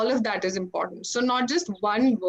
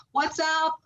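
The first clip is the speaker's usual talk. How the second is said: higher-pitched American-style "What's up?"; American greeting; 5 semitones higher than usual. Acted. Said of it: "you'll know everybody goes".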